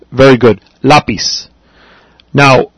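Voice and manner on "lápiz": Lápiz with the first syllable LA stressed. Shown as a demonstration. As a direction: repeat Lápiz for a learner, leaning on the A. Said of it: "you hear the stress on the A".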